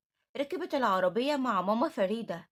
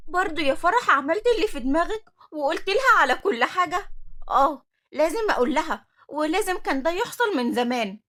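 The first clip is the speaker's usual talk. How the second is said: high, loud worried reproach; excited outburst; 6 semitones higher, 8 dB louder than usual. Acted. Friend confronts Farah, loud and anxious. Girls arguing excitedly in dorm.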